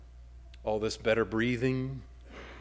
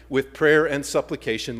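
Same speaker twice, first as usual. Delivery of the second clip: normal talking voice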